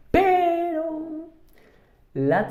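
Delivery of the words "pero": very high, held "Pero"; sing-song high Pero before the catch; drawn-out warning. Shown as a demonstration. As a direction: stretch Pero out on one high note. Teacher flags an exception.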